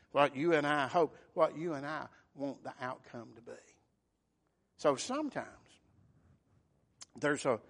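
ordinary talk, nothing marked

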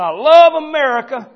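loud, high patriotic proclamation; impassioned mock flag-waver. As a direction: belt it out like an eager patriot, then rattle on. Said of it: "somebody being very patriotic".

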